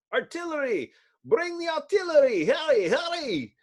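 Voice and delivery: high, frantic call for the artillery; urgent shout. Acted. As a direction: yell orders in panic. Soldier calling for guns.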